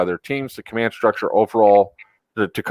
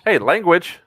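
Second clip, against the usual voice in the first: mock scolding; 8 semitones higher, slower than usual. Real, not acted. Scolding friend for cursing.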